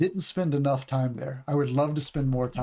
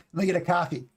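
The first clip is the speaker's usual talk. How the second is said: New Yorker ordering "coffee"; lightly put-on New York coffee order; New York accent; 3 semitones higher, much faster than usual. Teacher imitating a customer in New York, New York accent. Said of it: "like in New York".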